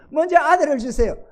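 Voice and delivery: high, loud acted plea; insistent high-pitched prayer; pleading Hannah. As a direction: cry out to God as a woman wanting a child.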